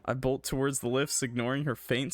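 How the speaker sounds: ordinary delivery, nothing marked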